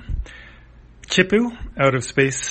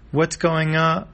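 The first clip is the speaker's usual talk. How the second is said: higher-pitched puzzled question; a touch of alarmed confusion; lightly acted bewilderment; mildly bewildered; 7 semitones higher, 4 dB louder, slower than usual. Chippu reacts to the chase.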